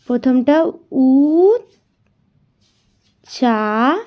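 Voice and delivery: উচ্চারণ drawn out very slowly; syllable by syllable. Shown as a demonstration. Teacher demonstrating syllable split for the class, slow. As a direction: stretch উচ্চারণ into separate syllables. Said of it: "দ্বিতীয়টা আমি করে দেখাই".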